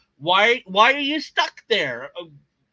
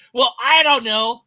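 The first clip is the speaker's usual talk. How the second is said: usual speaking voice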